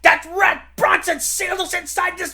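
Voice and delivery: high, loud outraged cry; trapped man cursing the villain. Acted. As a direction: shout at the trap in fury. Sealed inside the mine.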